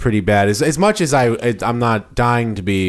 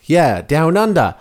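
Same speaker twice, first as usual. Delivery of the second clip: put-on Australian accent; 6 semitones higher, 4 dB louder, slower than usual. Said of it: "I never figure out that accent".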